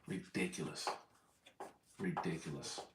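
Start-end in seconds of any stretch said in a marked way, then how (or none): none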